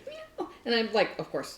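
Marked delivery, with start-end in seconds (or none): 0.1-0.5 very high, soft little meow; small squeaky cat voice. Acted. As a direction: imitate a cat with tiny high mews. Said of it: "he follows me into the bathroom".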